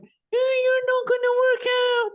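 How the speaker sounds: put-on squeaky little-kid teasing; childish voice. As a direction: taunt like a bratty little child. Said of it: "give it a childish voice".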